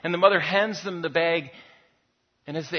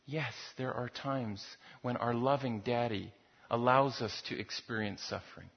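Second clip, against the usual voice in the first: ordinary talk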